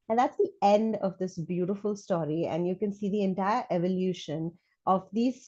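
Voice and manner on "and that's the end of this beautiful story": plain reading voice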